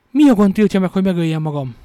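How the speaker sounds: high, very loud outcry; desperate shout. A narrator's reading. Woman with the knife challenges her captor, shouting. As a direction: shout it as a defiant demand. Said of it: "és így kiáltott".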